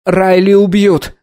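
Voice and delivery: high, drawn-out alarmed cry; boy's outcry. A narrator's reading. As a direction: cry out high like a frightened boy. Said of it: "выкрикнул мальчик".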